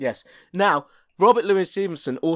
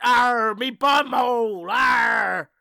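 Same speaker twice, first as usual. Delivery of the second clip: pirate shouting; 7 semitones higher, much slower than usual. Acted. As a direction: bellow like a pirate.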